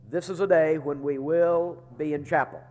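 ordinary talk, nothing marked

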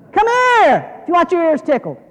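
high, loud mock come-on; big, high-pitched beckoning shout; hawker's call. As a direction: holler it out like luring in a crowd. Church mockingly calling people in.

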